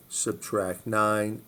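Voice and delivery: very slowly, each word drawn out; slow, deliberate repeat. Shown as a demonstration. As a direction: spell it out for students.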